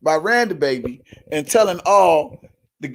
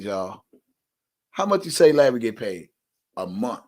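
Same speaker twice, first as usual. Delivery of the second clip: ordinary talk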